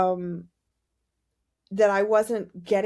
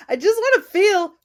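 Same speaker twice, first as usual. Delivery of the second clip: salty complaint; 6 semitones higher, 4 dB louder than usual. Retold or imitated. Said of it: "I was so salty with that".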